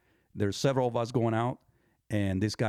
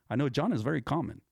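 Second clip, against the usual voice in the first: usual speaking voice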